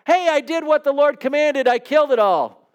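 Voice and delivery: mock-proud king bragging to the prophet; proud Saul. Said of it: "Saul comes to him and says".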